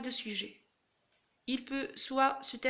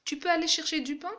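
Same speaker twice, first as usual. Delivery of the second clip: quick, higher, louder, the tune climbing on "aller" and "pain"; rising question intonation; 5 semitones higher, 5 dB louder, faster than usual. Shown as a demonstration. Teacher shows that intonation alone turns the sentence into a question, rising tune. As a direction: make it a question by voice alone, lifting through "aller chercher du pain". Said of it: "c'est juste l'intonation à l'oral".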